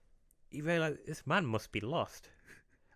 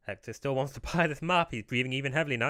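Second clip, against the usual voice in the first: normal talking voice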